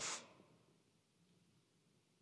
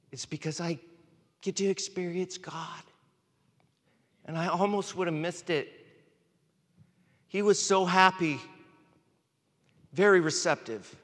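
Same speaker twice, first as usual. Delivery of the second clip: choked up with tears, voice rising higher; tearful; 6 semitones higher than usual. Real, not acted. Preacher tells the congregation about God, tearfully. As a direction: push through real tears of awe. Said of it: "I'm up here crying".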